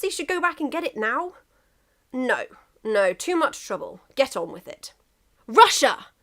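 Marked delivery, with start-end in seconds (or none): 5.5-6.1 calls the name out loud and sharp; a firm shout across the field. A narrator's reading. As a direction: drunk England calling out Russia's name. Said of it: "he said loudly".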